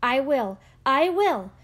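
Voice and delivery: can't wait to do it, fast; eager agreement. A narrator's reading. Poor man promising the rabbi, eager and fast.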